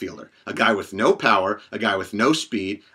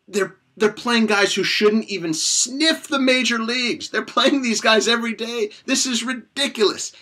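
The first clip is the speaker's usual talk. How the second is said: much higher, strained voice of disbelief; exasperated outburst; 12 semitones higher than usual. Real, not acted. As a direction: voice jumps way up in outrage, slowed on the first line. Exasperated fan rant peaks.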